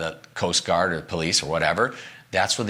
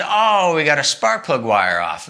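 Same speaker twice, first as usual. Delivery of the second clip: faked surprise; 5 semitones higher, 4 dB louder, slower than usual. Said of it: "I put on a pretty good acting job".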